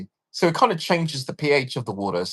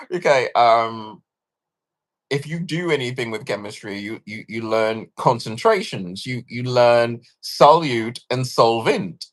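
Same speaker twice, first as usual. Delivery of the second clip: usual speaking voice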